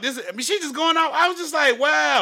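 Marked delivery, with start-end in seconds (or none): 1.8-2.2 stunned wow. Retold or imitated. Stunned by her cursing.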